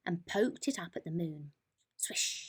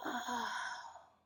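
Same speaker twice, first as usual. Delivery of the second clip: whispered; 4 semitones higher, 8 dB quieter than usual. A narrator's reading. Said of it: "whispered Mole".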